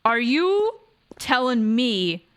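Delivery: drawn-out, incredulous question; slow disbelief. Real, not acted. Streamer questioning Sophie about the guy she likes, slow incredulity. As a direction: stretch it out in stunned disbelief.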